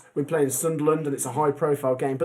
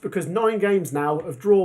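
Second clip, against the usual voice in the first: normal talking voice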